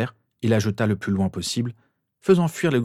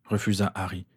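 plain reading voice

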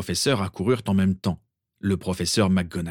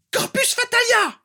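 much higher, louder screamed curse; screamed spell; 4 dB louder than usual. A narrator's reading. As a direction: hurl the curse at full voice. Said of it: "hurla Bellatrix".

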